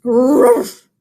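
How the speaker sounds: loud gruff ruff; a hammed-up growling ruff; bear growl. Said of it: "Old Bear growled".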